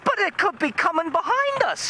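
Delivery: high falsetto alarm; imitated frightened mother, very high; mock panicked wife. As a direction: squeal it up high, hammed-up fear. Retold panicking wife answers.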